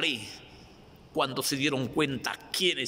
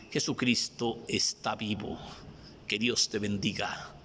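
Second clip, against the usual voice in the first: ordinary delivery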